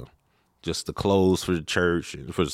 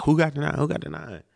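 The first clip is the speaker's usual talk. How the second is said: curious classmates; 9 semitones higher than usual. Retold or imitated. Classmates asking around excitedly.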